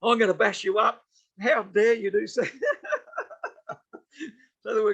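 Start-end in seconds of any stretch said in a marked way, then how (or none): 0.0-2.4 higher-pitched mock indignant threat; playful imitation of angry scolding; mock outrage. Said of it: "so he chased him down the road". 2.5-4.0 high, breaking chuckle after a joke; amused high-pitched laughter; real laugh. Real, not acted. Laughing at own anecdote.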